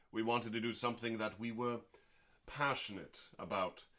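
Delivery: usual speaking voice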